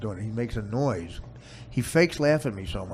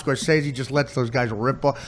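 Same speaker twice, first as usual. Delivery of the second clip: ordinary talk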